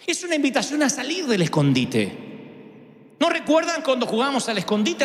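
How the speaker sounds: ordinary delivery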